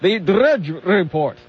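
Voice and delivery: slow, drawn-out "Drudge Report", R's rolled. Shown as a demonstration. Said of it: "do that one more time".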